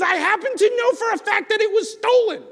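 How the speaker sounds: high, loud indignant charge; angry accusation. Acted. Leather worker accuses shopkeeper of stealing, high angry charge. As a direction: hurl the accusation at him. Furious man accuses neighbor of theft.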